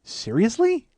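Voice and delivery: high, incredulous question; stunned disbelief. Retold or imitated. Woman frozen by his answer, stunned disbelief. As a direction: pitch it up as if taken aback. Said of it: "froze and she just goes".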